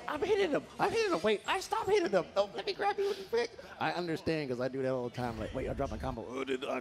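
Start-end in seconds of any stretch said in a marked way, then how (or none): 0.1-3.5 high, loud, flustered imitation of a player; mock panicked player. Caster voicing a player who keeps hitting, mock panic. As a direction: act out confused panic, pitch shooting way up.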